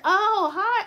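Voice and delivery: high, loud, sugary hello; mock sweet greeting. The speaker greeting the pastor with loud fake sweetness.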